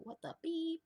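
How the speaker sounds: high squeaky "What the beep?"; quick cartoon censor squeak; TV bleep voice. His new reaction when something's fucked up, squeaky high. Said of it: "I just go".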